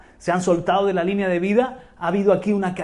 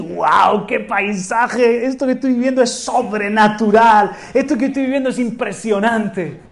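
loud, stretched wow at the view; awed wonder; 3 semitones higher, 5 dB louder than usual. Pretend. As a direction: marvel at the scenery. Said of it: "hasta puedo decir".

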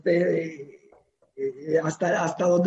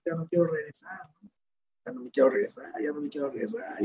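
hushed, softer tearful pleading, fading almost to a whisper; imitating crying daughter; 3 semitones lower, 8 dB quieter than usual. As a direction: mimic a teenage girl begging through tears. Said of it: "Y llorando". (hija llorando rogando que no la manden, imitada en voz baja)

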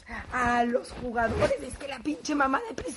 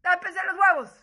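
louder, higher shouted warning; mock shouting; 6 semitones higher, 9 dB louder than usual. Wife shouting at players to cover up, loudly. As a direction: yell it through the door. Calling into locker room.

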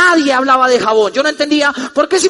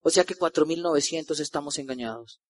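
skeptical inner thought; 7 semitones lower, 8 dB quieter than usual. Retold or imitated. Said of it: "yo decía".

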